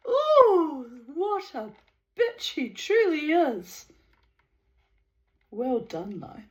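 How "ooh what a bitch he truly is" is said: high, delighted ooh; gleeful aside. Real, not acted. Reader reacting to the story, gleeful. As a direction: relish the gossip.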